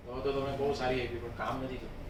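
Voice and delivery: very soft, low, quick veiled taunt; soft sarcasm. Acted. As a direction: slip the dig in softly, dropping almost to nothing. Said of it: "softness".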